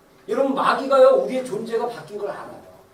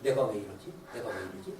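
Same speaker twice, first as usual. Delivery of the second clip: low, much softer confused mutter; muttering to oneself; 11 dB quieter than usual. Retold or imitated. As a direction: grumble under your breath, baffled at yourself. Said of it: "이러면서 막 승질내요". (훨씬 작고 낮게 혼잣말로 중얼거림)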